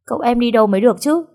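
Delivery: fast, high, anxious pleading; frantic question. A narrator's reading. Maid frantically asking where her master went, fast and high. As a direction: rush it out in a panic.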